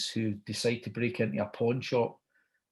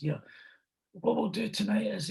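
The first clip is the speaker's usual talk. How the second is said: high put-on star voice; Al Pacino; 10 semitones higher than usual. Star lays out tonight's plan to the host, high imitation. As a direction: imitate the star in a raised pitch. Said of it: "So he says".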